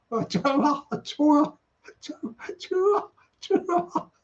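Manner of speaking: trying out "tour" repeatedly, pitch climbing high; struggling to say tour. Real, not acted. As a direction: keep retrying "tour" as if the word won't come out right. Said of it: "I can't even say tour".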